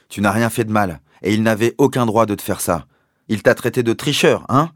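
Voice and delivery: loud, rising indignation; furious father. A narrator's reading. Father furious that the captain called Danny a cheater, loud and indignant. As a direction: rage on the boy's behalf.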